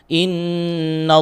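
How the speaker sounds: "Innallaha" with an o-coloured thick l; thick lam. Shown as a demonstration. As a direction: recite "Innallaha", long n then full rounded lam. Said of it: "tebal, la".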